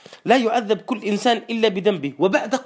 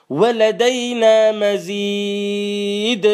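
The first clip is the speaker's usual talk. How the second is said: slow drawn-out chant of ولدينا مزيد; Quran recitation; much slower than usual. Acted. Preacher reciting the verse to the congregation, slow chant. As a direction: stretch ولدينا مزيد long and level.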